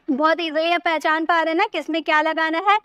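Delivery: ordinary delivery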